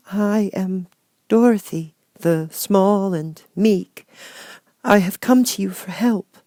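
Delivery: put-on a meek little girl finding courage; little Dorothy. Dorothy answers Oz.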